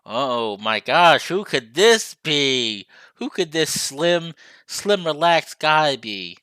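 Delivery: slow, drawn-out admiring; slow flirty wonder; swooning. Real, not acted. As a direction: savour each word over the slim guy. Swooning over handsome visitor.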